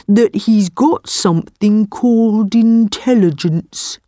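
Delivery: unhurried, deliberate buffalo; slow buffalo voice. A narrator's reading. Buffalo tells tiger about intelligence, slowly. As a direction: take your time like a big calm beast. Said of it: "said the buffalo slowly".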